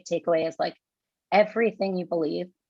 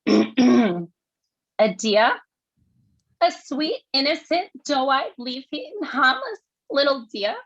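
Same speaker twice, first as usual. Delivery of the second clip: put-on brassy New York accent pitched higher; strong New York accent, higher and punchy; 6 semitones higher, 4 dB louder, slower than usual. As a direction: deliver it like a fiery New York monologue. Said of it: "So it's New York accent".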